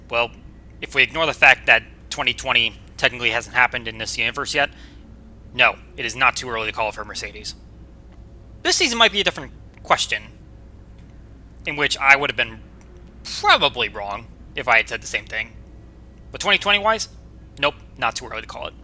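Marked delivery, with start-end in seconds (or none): none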